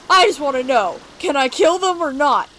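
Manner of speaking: loud, angry demand; impatient demand. Acted. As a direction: raise your voice, fed up and demanding. Character demands permission to kill.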